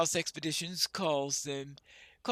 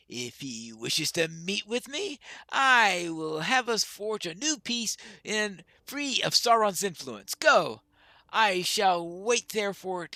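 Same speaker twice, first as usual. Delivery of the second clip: lightly voiced, formal dwarf elder; stately dwarf sending word to a kinsman; a hint of a proud dwarf, deliberate; solemn dwarf leader; 4 semitones higher, 6 dB louder, slower than usual.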